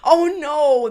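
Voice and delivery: loud high laughing shriek. Real, not acted. Host reacting to the tart breath line, loud shrieking laugh. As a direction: scream with laughter.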